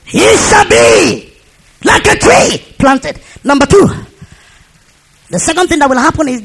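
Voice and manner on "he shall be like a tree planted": very high, slow shouted verse; drawn-out preacher's cry; proclaimed scripture. Real, not acted. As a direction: cry the verse out, stretching each word.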